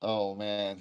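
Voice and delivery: usual speaking voice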